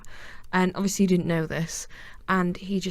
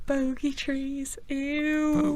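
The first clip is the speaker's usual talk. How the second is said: disgusted; 8 semitones higher, much slower than usual. Real, not acted. Disgusted reaction.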